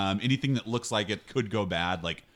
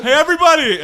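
loud call to the whole room; yelling; 16 dB louder than usual. Acted. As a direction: bellow it across the room. [name] yelling out again.

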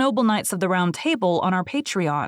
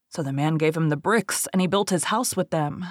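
usual speaking voice

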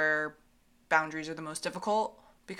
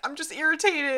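a lightly voiced husband, higher and louder; imitating husband; 9 semitones higher, 7 dB louder than usual. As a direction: mimic him, annoyed but not mad. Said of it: "And he was like".

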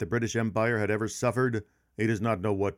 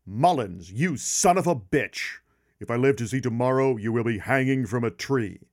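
furious general's threat, raised voice; retold angry yell; 4 dB louder than usual. General yells at Mullins over the missing ladders, angry retold yell. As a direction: yell it out like an outraged commander, part acted. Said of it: "one of the generals actually yelled out".

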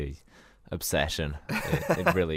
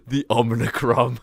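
lightly voiced robot villain. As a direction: announce it like a movie baddie. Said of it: "villains from Transformers".

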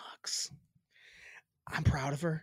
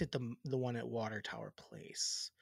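hushed; 6 semitones lower, 10 dB quieter than usual. Real, not acted. Gossipy dig at cohost's store.

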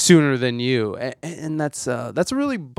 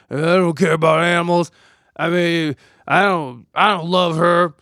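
gruff tough guy; 2 semitones higher, 3 dB louder than usual. Retold or imitated. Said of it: "I thought I was a man that".

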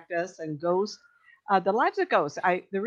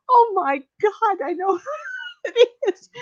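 imitated astonished recognition; 5 dB louder than usual. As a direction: act out a floored woman while laughing. Woman amazed, hands to her face.

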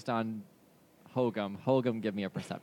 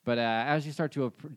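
normal talking voice

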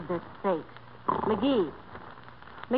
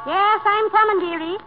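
calling out. Acted. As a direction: call out from upstairs, sing-song. Calling to someone at the door.

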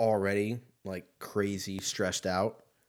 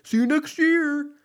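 very high, loud squeaky mimicry; cartoonish high impression of a cheesy coworker; mocking office joker; 10 dB louder than usual. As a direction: mimic the annoying joker in a shrill put-on. Mocking an office joker on New Year's Eve.

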